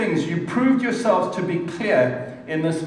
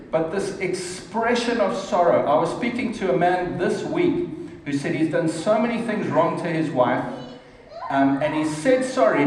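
ordinary talk

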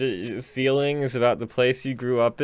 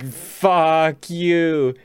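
drawn-out mock insult; 3 semitones higher, much slower than usual.

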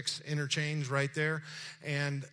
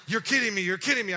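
higher and louder, amazed disbelief; 8 semitones higher, 10 dB louder, faster than usual. Retold or imitated. Caller amazed the pastor is so close, loud disbelief. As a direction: blurt it out in astonishment. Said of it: "He goes".

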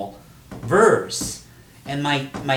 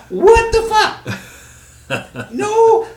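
drawn-out, high, loud laughing outrage; mock disbelief, stretched out; laughing exasperation at open-mic poets; exasperated; 8 dB louder, much slower than usual. Real, not acted. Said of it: "exasperated at poets, laughing".

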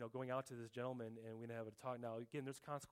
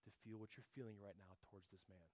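gentle; 3 semitones lower, 9 dB quieter than usual. Retold or imitated. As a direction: speak it very gently, like a loving father correcting. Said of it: "very, very gently say to me".

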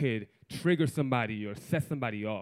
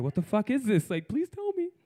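rising, bewildered plea; confused pleading; 7 semitones higher than usual. Retold or imitated. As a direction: beg to know, voice climbing. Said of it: "I'm like".

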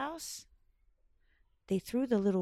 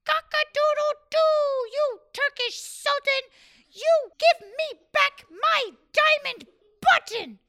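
high, loud indignant crow; rooster demands; 11 dB louder than usual. Acted. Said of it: "And he said".

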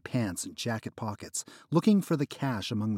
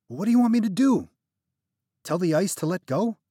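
higher, louder, fast exasperated retort; 9 semitones higher, 7 dB louder than usual. Acted. Narrator snapping at friend who refuses the knife. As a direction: fire back, exasperated.

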